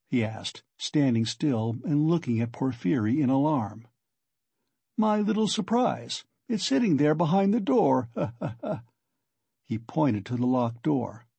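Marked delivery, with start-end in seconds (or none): 5.0-8.1 higher, teasing lilt; playful, good-humored. A narrator's reading. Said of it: "become more playful and good-humored". 8.1-8.8 short breathy teasing laugh; acted chuckle. Porfiri laughs about his surprise.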